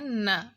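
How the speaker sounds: न hummed through the nose, nasal; teacher voices न through the nose. Shown as a demonstration. Teacher showing kids a nasal न. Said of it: "थोड़ा हमें इसको नाक में बोलना है".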